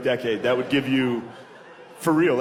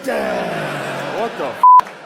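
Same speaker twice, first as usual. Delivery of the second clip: shocked outburst; 11 semitones lower, 8 dB louder, much slower than usual. Retold or imitated. Reacting to teeth at tour end.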